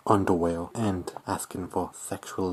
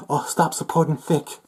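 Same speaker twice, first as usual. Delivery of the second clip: higher mock-pleading quote; mocking demand; 7 semitones higher, 3 dB louder than usual. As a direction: parody the demand, pitched up.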